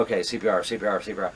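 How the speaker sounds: quiet, low rehearsing under breath; quietly running through CPR steps in his head; muttering to self. Retold or imitated. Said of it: "So in my head".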